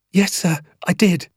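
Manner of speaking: lightly voiced pupil owning up; schoolboy confessing. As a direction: answer sir in a small high voice. Pupil confesses to angry teacher.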